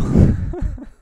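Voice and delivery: loud, breathy burst of laughter; sudden loud laugh. Real, not acted. Laughs after her remark on colours.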